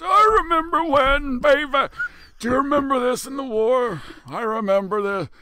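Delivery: high-pitched put-on old-timer voice; old veteran reminiscing. Veteran swapping war stories at the Legion, hammed-up high old-timer voice. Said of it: "we start telling all these old stories".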